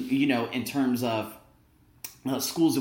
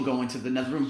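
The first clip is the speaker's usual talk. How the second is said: usual speaking voice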